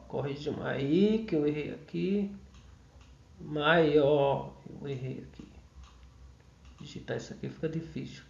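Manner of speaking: low, very soft talking to oneself; muttered aside. Real, not acted. Teacher fixing a typo mid-lesson, muttered low aside. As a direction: drop to a mumble while fixing a mistake. Correcting own typing slip while teaching.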